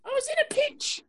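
high, lightly put-on British voice; a hurt Brit who was in a pinch; mock British. Answering a jab about his robe, light mock British accent.

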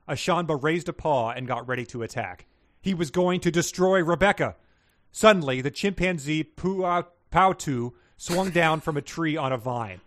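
lively storybook read-aloud; story reading. Acted. Host reads listener fanfic to co-host, dramatic read-aloud. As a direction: read the fan fiction like a bedtime adventure.

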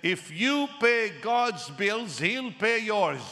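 father-in-law giving the key maxim; weighty maxim. Retold or imitated. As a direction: deliver the big line slowly. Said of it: "He said".

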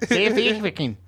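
squeaky-high botched South African accent. Shown as a demonstration. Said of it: "I just go higher".

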